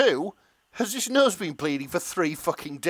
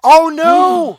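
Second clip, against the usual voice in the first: loud, high shocked shriek; a piercing comic wail; 16 dB louder than usual. Real, not acted. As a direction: shriek in mock horror at the twist. Reacting to fanfic kiss.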